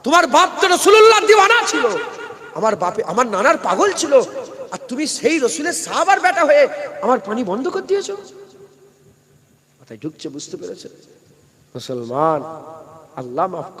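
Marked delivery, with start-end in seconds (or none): 0.0-8.2 high, loud anguished reproach; Husain's rebuke. Acted. Husain rebuking Amr bin Sad at Karbala, loud and high. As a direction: cry out the betrayal to his face. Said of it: "Hussain হুজুর বলেছিল".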